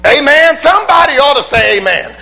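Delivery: high, loud call-and-response; call for amen. Acted. Preacher urging the congregation to answer amen, loud and high. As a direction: demand an answer from the pews.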